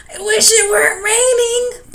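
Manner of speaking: high, loud, breathless cry; tired dragon panting in the rain. A narrator's reading. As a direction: gasp it out, worn out from flying. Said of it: "panted the dragon".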